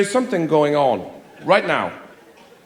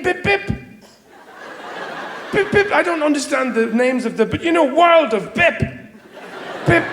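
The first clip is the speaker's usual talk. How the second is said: high, silly "Bip" chirps; high mocking video-game beeps; mock game noises; 9 semitones higher than usual.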